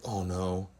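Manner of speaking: low, soft mock dread; sinking dread. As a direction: let it drop low and quiet, dreading it. Retold dread at office summons.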